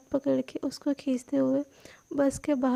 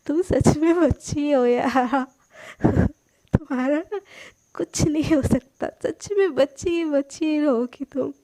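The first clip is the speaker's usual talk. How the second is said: louder, laughing as she teases; 5 dB louder than usual. A narrator's reading. Sana teases Palak, laughing loudly. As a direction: tease through giggles. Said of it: "लोट पोट के हंसती है".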